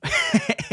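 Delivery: a sudden high-pitched giggle breaking out; real burst of laughter; high squeaky laugh. Real, not acted. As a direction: crack up into a squeal. Laugh at own retelling.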